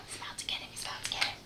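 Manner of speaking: hushed whisper building suspense; suspenseful whisper. A narrator's reading. Narrator telling children the boy is about to eat Melvin, a tense whisper. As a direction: whisper it like a secret danger.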